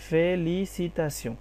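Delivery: slow, separated syllables in ফেলিসিতাসিও. Shown as a demonstration. Teacher checking that learners understand, slow syllable by syllable. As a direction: break ফেলিসিতাসিও into slow, even pieces. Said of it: "বুঝতে পারছেন?".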